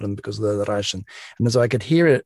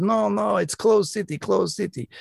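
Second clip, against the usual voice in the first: put-on Russian accent, much higher and insistent; Russian minder insisting; 10 semitones higher than usual. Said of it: "And he said".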